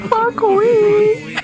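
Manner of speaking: loud crowd shout; scream. Acted. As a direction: yell it at the top of your voice. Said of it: "I want everyone to scream".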